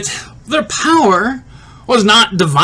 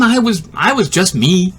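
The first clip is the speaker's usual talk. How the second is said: ordinary delivery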